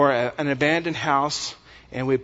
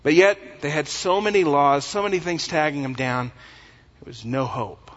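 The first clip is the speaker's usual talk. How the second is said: ordinary talk, nothing marked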